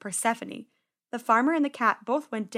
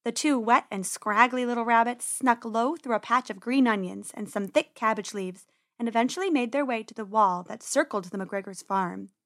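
ordinary delivery, nothing marked

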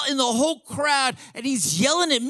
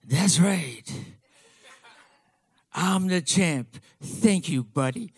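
low, unhurried impersonation of the champ; Muhammad Ali; 8 semitones lower, slower than usual. Retold or imitated. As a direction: play a big boxer answering a kid, deep and slow. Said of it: "and he was like".

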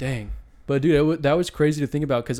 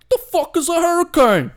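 high, loud, put-on British accent shouting; hammed-up British voice, much higher and louder; mock British; 11 dB louder than usual. Mocking a Brit who has never heard of hurricanes, high loud British accent. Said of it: "you go to Britain, they're like".

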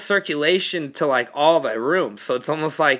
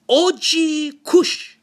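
put-on slow, higher accented O G Kush; imitating the dad; 11 semitones higher, 3 dB louder, much slower than usual. Dad repeating the customer's weed pick, slow accented wonder. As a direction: stretch out O G Kush like a puzzled dad. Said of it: "this guy, he like".

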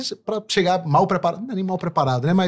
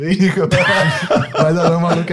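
laughing call-out; 4 dB louder than usual. Retold or imitated. Retold hug, calling out laughing.

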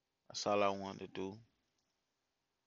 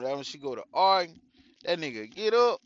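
ordinary talk, nothing marked

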